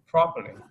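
PROperly, first syllable punched high and loud; stress on first syllable. Shown as a demonstration. Tutor correcting a student's stress, loud model word. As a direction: hit the start of "properly" hard. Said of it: "nhấn trọng âm đầu tiên".

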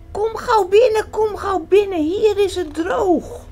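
very high and loud, crying out a welcome; calling in. A narrator's reading. Hare at his door calling the flooded friends inside. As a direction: wave friends in from the water.

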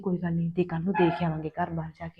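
soft, secretive telling; hushed voice. Acted. Wife secretly tells husband about the match, hushed voice. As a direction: confide it softly, keeping it secret. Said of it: "ਹੌਲੀ ਬੋਲੋ".

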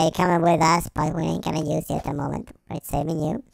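normal talking voice

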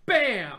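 loud explosive bam; shouted cannon boom. Pretend. Cannon shot sound effect.

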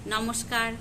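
ordinary talk, nothing marked

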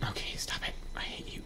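hushed, voiceless grumble; very soft whispered complaint; exasperated whisper. Real, not acted. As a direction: whisper it under your breath. Mutters at game enemy.

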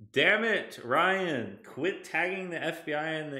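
loud, high playful scolding; loud exasperated. Real, not acted. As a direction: call out the troll in chat. Scolding chat member, loud.

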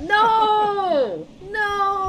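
high, loud panicked no; shouting. Real, not acted. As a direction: yell it in alarm. Alarm at team leaving.

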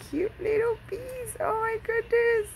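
high-pitched squeal of delight; high, adoring coo; delighted gushing. Real, not acted. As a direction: squeal it high, melting over something cute. Gushing over cute little bees.

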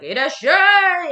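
loud long ramen-shop welcome call; shop owner's welcome. Retold or imitated. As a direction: belt it like an old ramen chef greeting customers. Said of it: "the old man is smoking a cigarette and he's like".

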